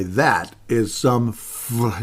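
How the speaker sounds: slow drawn-out nerd voice, then stopped short; Angry Video Game Nerd. Retold or imitated. Host starts the nerd's curse, slowly drawn out, then stops. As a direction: stretch out the curse but cut it off. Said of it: "As the angry video game nerd might say".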